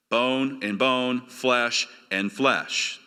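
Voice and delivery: each word drawn out, very slow; slow emphatic repetition. Shown as a demonstration. Preacher to congregation, slowly savouring the words. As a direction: savour bone and bone, flesh and flesh slowly. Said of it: "Do you hear that?".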